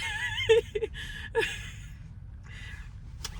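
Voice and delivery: squeaky unvoiced laugh that almost cries; half laugh half sob; high breathless laugh-cry. Real, not acted. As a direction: let a helpless laugh crack high.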